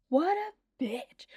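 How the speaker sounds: slow, breathy aside; whispered insult. Real, not acted. Vlogger cursing Missus Chow to viewers, slow whisper. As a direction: hiss it under your breath.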